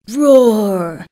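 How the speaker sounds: drawn-out spoken "roar", falling; storybook roar at talking volume; mock lion roar. A narrator's reading. Trapped lion calls out, mild mock roar.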